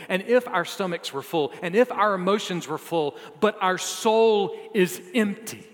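full-force sermon peak; preaching at full force. Acted.

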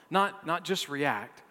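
ordinary talk, nothing marked

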